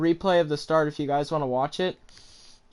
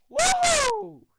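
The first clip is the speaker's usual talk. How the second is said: excited whoop. Real, not acted. Cheering after winning race.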